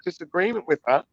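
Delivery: ordinary delivery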